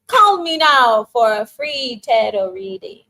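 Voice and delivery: slow, loud mock TV psychic ad read; hammed-up late-night psychic hotline pitch; tarot commercial. Streamer recalling an old tarot ad, in a slow, loud ad voice. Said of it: "Does anybody remember that commercial?".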